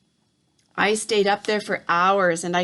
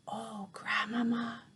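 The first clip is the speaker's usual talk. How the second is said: soft, slow frightened breath of a plea; a terrified boy calling inside his head; 12 dB quieter than usual. A narrator's reading. Boy in tree silently begging grandmother, hushed and afraid.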